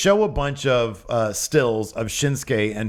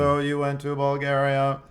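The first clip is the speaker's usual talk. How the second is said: robotic interviewer; 2 semitones higher than usual. Acted. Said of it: "my interview subject I have been programmed".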